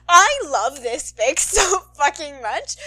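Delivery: real laughter breaking through the words; laughing. Real, not acted. Podcaster gushing about the fic, laughing through it. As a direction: gush while laughing. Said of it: "I don't know if you could hear it".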